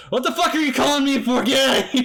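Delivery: high, loud furious yell through laughter; angry supervisor. Acted. Supervisor yelling at the agents over the phone, furious shout. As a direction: blow up at the caller. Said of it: "Supervisor just says".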